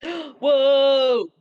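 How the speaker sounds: sharp gasp into a loud, high whoa; startled listener gasps and shouts. Real, not acted. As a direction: caught off guard, let it burst out. Gasp at Vader's surprise jump.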